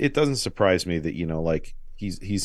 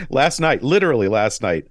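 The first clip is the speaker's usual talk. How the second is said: ordinary delivery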